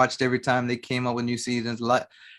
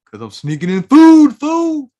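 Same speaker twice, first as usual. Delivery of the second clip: loud mock retort; 10 semitones higher, 11 dB louder than usual. Mock comeback to nosy stranger.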